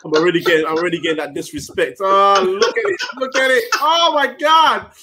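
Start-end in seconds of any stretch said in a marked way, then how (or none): 2.0-4.9 loud, high-pitched exasperated outburst; mock outrage. Real, not acted. Host teased by his co-hosts over a bad accent, loud mock outrage. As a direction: cry out in exaggerated disbelief, drawing out the end.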